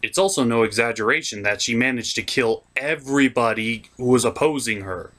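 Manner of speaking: usual speaking voice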